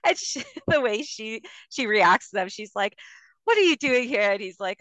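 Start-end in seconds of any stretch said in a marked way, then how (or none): 3.4-4.3 lightly mimicked sassy mother, higher and louder; Mandy Cohen, sassy. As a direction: retell her blunt challenge to unexpected visitors. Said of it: "She's all sassy with them".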